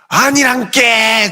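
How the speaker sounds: high, drawn-out shout; worked-up dialect denial. Acted. Peter denying it to accusers in Galilean dialect, worked-up shout. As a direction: blurt it out loudly in country dialect. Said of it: "막 흥분하면서 얘기하는데".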